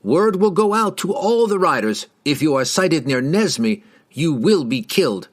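high start, settling into threat; threatening warning. A narrator's reading. Rider threatens strangers near his town, warning. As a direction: deliver the threat as a proclamation.

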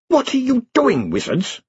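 sharp, high, angry snap; snapped. A narrator's reading. Queen snapping at the meddling wizards, sharp and high. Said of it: "she snapped".

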